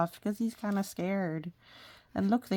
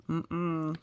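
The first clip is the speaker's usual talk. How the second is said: usual speaking voice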